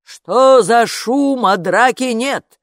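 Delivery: loud, very slow drowsy father; sleepy dad. A narrator's reading. Father woken from sleep, calling down sleepily. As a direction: call down, half asleep. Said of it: "сонный голос".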